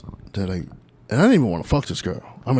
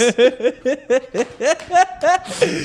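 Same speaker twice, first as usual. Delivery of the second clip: big high laugh; 14 semitones higher than usual. Real, not acted. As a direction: crack up after the impression. Laughing after the impression.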